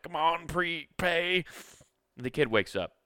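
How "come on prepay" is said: impatient plea. Retold or imitated. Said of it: "He's just like".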